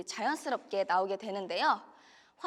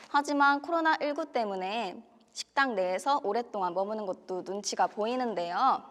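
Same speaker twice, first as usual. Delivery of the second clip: ordinary delivery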